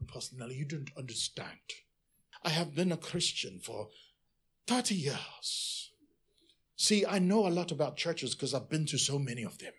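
mock smug member lecturing the pastor; a hint of a self-important know-it-all; know-it-all churchgoer. Pastor voicing a smug member telling him how to run church.

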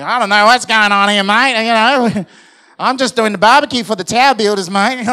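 loud hammed-up Australian accent. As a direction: play a cheery Australian bloke at the barbecue. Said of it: "the other one's an Australian".

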